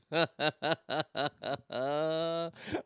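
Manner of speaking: quiet, rapid-fire laugh trailing off; long chuckle. Real, not acted. Host amused by a listener's message, long chuckle. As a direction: laugh it out in a steady run.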